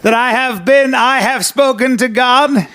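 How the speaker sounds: pompous Englishman announcing revelation; hammed-up English accent; mock English accent. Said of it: "The problem is I don't have an English accent".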